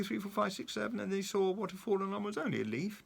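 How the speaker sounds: ordinary delivery, nothing marked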